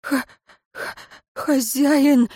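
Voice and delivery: high, slow stuttered appeal; stammering plea. A narrator's reading. Wounded servant begs master, stammering. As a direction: catch on the first sound, then plead. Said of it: "запинаясь, взмолился прислужник".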